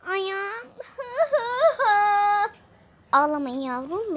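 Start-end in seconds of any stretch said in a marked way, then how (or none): 0.7-2.5 high crying wail; wailing sob. Acted. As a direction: let out a wail. Child crying after hurt. (ağlama sesi)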